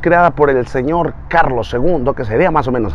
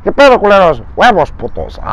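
Escorpión Dorado voice; 7 semitones higher than usual. Retold or imitated. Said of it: "A ver, así".

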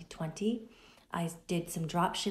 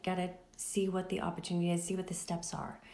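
normal talking voice

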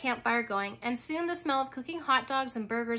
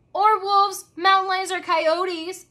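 loud, higher list of dangers; warning; 8 semitones higher, 8 dB louder, slower than usual. A narrator's reading. Teacher warns kids about wild animals, loud. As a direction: warn the kids firmly.

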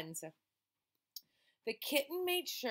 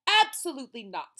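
firm refusal; 4 semitones higher, 11 dB louder than usual. Acted. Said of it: "said the boy's mother".

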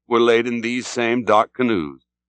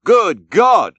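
high, loud exclamation of shock; English captain's astonished outburst; startled cry; 8 semitones higher, 5 dB louder than usual. A narrator's reading. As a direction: cry out aghast, both words pitched up. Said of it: "cried the English captain".

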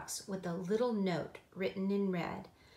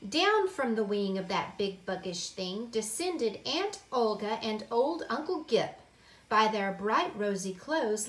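usual speaking voice

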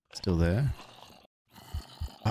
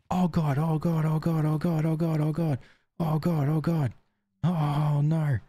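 gibber in real panic; terrified gibbering; 8 semitones higher than usual. Real, not acted. Gibbering as things go wrong.

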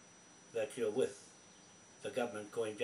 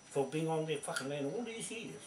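ordinary talk, nothing marked

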